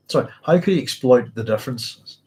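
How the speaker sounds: usual speaking voice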